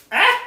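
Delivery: sudden high shriek of alarm; a sharp yelp of surprise; startled yelp. Real, not acted. Dog grabs the parchment paper.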